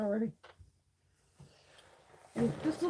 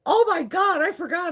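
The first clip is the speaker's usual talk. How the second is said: mock panicked husband; 11 semitones higher, 5 dB louder than usual. Husband voiced realising he forgot.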